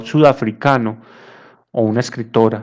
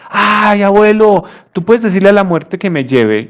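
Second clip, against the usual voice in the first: put-on pleading child; 7 semitones higher than usual. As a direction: beg grandpa like a little boy. Child begs grandfather. (niño rogándole al abuelo con voz aguda)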